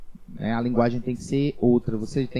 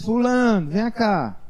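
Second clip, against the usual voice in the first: high, raised call across the yard; 8 semitones higher, slower than usual. Retold or imitated. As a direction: call the student over, voice lifted high. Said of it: "ele elevando o tom de voz". (chamando o aluno em voz alta)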